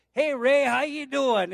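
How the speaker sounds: high, loud retold phone greeting; cheery greeting. Acting caller's greeting on phone.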